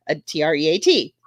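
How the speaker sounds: T R E A T spelled letter by letter. Shown as a demonstration. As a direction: spell out T R E A T, one letter at a time. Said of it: "I don't wanna say the word".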